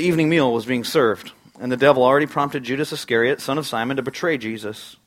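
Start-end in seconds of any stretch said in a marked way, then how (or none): none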